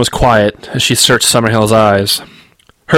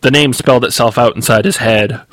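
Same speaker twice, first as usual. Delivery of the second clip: usual speaking voice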